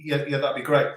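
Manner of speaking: ordinary delivery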